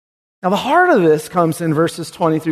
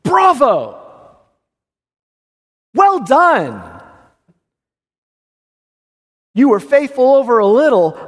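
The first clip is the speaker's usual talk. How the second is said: higher, louder hearty praise; delighted master; 6 semitones higher, 3 dB louder than usual. Acted. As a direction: cheer him on like a proud master. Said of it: "the master comes back and says".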